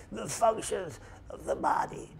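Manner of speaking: hoarse airless whisper of a sick man; breathy whisper. Shown as a demonstration. Hospital patient with pneumonia whispering to his visitor. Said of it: "He's talking like, he had pneumonia".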